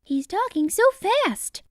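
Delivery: high-pitched crowd guess; high storybook crowd voice. A narrator's reading.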